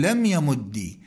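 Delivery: "يمد" ending in "i", yamuddi; kasra ending. Shown as a demonstration. Teacher showing that kasra is allowed, "يمد" ending in kasra. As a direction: close "يمد" on a doubled d plus "i". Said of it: "والكسر أيضًا يجوز".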